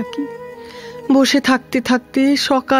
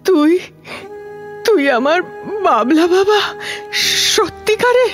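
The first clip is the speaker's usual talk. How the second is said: halting, high-pitched emotional question; overwhelmed disbelief; 7 semitones higher than usual. Acted. Old nanny recognises the boy she raised, overwhelmed. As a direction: climb haltingly into amazed, hopeful disbelief.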